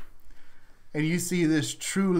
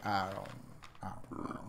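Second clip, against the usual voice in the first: whispered frog reply; 8 semitones lower, 15 dB quieter, faster than usual. Retold or imitated. Frogs voiced by the DM.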